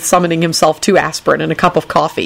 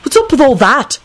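high, urgent demand; 9 semitones higher than usual. A narrator's reading. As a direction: demand an explanation. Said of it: "Neville demanded".

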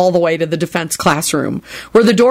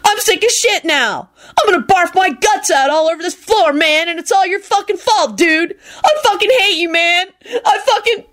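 whiny sick tantrum; 12 semitones higher than usual. A narrator's reading. Kenny raging, nauseous.

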